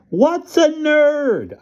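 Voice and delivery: high, drawn-out mom call from the kitchen; yelled question from the kitchen; mother yelling. Acted. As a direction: call it out slowly like a mom down the hall.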